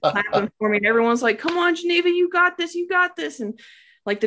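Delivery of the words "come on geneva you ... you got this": high, encouraging cheer; cheering her on. Retold or imitated. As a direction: cheer her through the last push.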